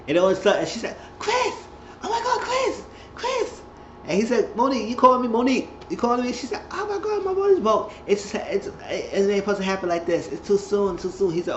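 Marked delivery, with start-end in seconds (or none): 1.2-3.6 very high-pitched urgent cry; mock panicked calling. Acts Monique calling Chris in panic. 4.6-6.3 high-pitched questioning; acted startled answer. Acts Chris answering a sudden call. 6.7-11.3 mock panic. Acts Monique panicking over waters breaking.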